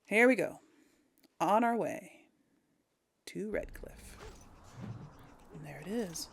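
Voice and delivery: normal talking voice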